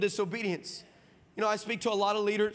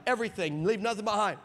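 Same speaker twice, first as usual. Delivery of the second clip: usual speaking voice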